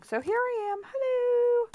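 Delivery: playful high-pitched hello to the camera; sing-song hello. Real, not acted. Sing-song greeting to viewers.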